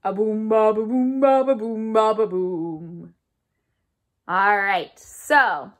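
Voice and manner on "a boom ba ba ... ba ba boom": mildly low bass thump imitation; upright bass sounds. Shown as a demonstration. Teacher to kids, lightly imitating a bass. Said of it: "that sounds something like this".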